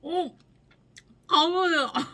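high shocked sour yelp; surprised cry. Real, not acted. Sour bite reaction.

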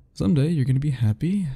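normal talking voice

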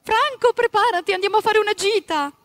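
high, loud woman's call; cheerful wife calling her husband. Acted. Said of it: "venne svegliato da una voce femminile".

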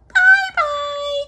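tiny, very high farewell squeak; high squeaky voice. A narrator's reading. Littlest bear calling goodbye, high and squeaky. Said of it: "squeaky voice calling to her".

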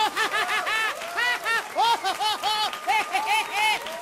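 long high cartoon cackle; SpongeBob laugh. SpongeBob laughing in his high cartoon voice. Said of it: "den Sprecher gut imitieren".

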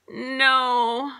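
high, loud, drawn-out groan. A narrator's reading. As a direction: groan it in dismay. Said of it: "my mom groaned".

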